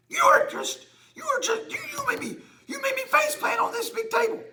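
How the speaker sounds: high whining voice; high whiny grumble; whiny complaint. Acted. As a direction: whine like a hurt kid. Host whining after faceplant.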